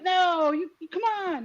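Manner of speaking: imitated dismayed parent; retold protest. Acted protest to daughter.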